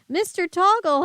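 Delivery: high, loud relieved cry; relieved cry to rescuer; cried happily. Acted. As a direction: call out in relief. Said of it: "I cried happily".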